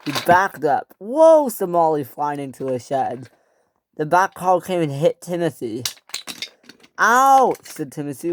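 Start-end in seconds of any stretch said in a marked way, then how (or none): 1.0-1.5 high loud whoa while flying; loud high startled whoa; alarmed whoa. Acted. Molly flying into shed. 7.0-7.6 high loud yelp; pained ouch. Acted. Back car hits Timothy.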